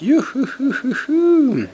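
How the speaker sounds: high, slow, drawn-out cheer of joy; high sung youhou. Real, not acted. Gamer cheering a big hit with a high youhou.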